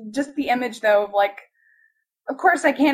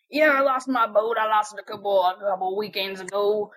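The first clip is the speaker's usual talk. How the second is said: low, dopey guy voice; boat owner; 4 semitones lower than usual. Retold or imitated. Boat owner answering the pranksters' call, low dopey voice. Voicing slow-witted man who lost boat.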